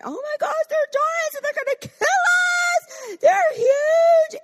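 high, loud, squealing cry; shrieking, over-the-top scaredy-cat; mock panic. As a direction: wail it in hammed-up terror. Acting out the panicked other spies.

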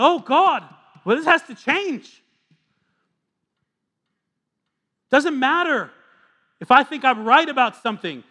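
fiery sermon delivery; full-force preaching. Acted. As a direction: preach it out over the room.

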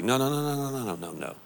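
fading run of no; emphatic denial. Real, not acted. Preacher rejecting luck, fading denial.

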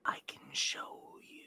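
eerie, airy whisper; whispering flame entity. Acted. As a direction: tempt them in a ghostly whisper. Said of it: "and goes".

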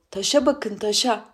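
high-pitched mocking call; jeering. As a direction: jeer like women in a crowd. Women jeer at the stone.

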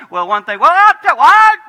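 mock argument rising to a high, loud "what?"; escalating argument. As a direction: build up to a shouted squeak, as in a marital spat. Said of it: "how it starts with".

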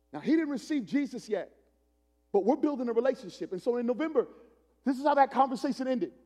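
high, loud revival preacher; full-force preaching. Acted. Preacher telling the congregation about street outreach, high and loud. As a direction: project the story to the whole church.

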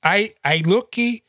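high, halting broken English as the customer; broken English accent. Retold or imitated. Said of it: "I go up to the guy and he says".

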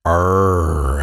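long growling "arr"; snarl. A narrator's reading. Boy turning alligator, drawn-out snarl. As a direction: snarl like an animal. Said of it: "Alan snarled".

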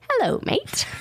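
imitating a little boy. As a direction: squeak it out like a small child. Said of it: "he'll come up to you and say".

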